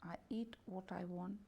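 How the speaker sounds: ordinary delivery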